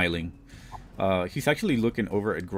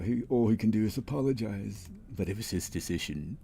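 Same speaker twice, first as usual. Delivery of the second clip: ordinary talk, nothing marked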